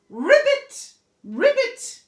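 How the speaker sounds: high, loud ribbit; frog croak. Acted. Frog answers Olive from the pond, croaking ribbit. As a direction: croak back like a little frog.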